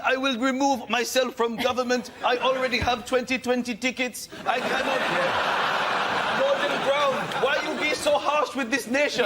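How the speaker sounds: bad Mugabe impression gone West Indian; West Indian-ish accent. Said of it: "it went a bit West Indian".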